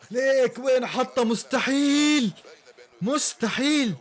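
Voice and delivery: stunned delight; amazed. Real, not acted. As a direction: marvel at the finish. Amazed at a goal.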